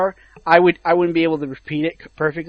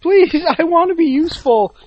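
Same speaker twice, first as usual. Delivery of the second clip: high, louder desperate plea; pleading Kinect; 11 semitones higher, 5 dB louder than usual. Acted. The Kinect begs its owner to keep it, pleading. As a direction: beg pitifully in a raised voice.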